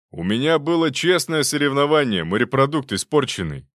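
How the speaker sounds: mildly hammed-up indignation; indignant retort. A narrator's reading. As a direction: snap back at an old rival. Acted squabble between two doctors.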